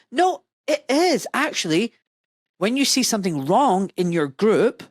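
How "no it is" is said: high, slow insistent contradiction; emphatic rebuttal. Real, not acted. Doctor answering colleagues' objection, emphatic rebuttal. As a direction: push back with raised pitch, drawn out.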